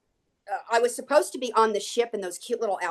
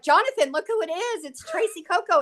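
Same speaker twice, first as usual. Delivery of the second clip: excited announcement; 8 semitones higher than usual. Retold or imitated. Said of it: "she was like".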